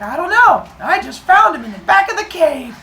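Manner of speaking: hammed-up innocent child; fake innocent. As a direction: play dumb like a kid caught red-handed. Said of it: "and Hermes is like".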